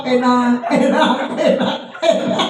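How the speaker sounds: loud breathy laughing chant of enak; mock tasting. Preacher mocking those who praise the food, laughing chant. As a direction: smack it out, laughing, as a mock taste test.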